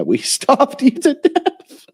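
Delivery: high, slow, laughing through it; laughing mock taunt. Mocking the Irish joke, slow and laughing. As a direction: giggle out a cruel joke.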